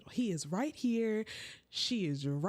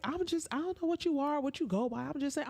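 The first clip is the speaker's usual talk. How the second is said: lightly mimicked dismissive girl, higher; mock defensive; 8 semitones higher, faster than usual. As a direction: play her shrugging it off. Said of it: "She was like".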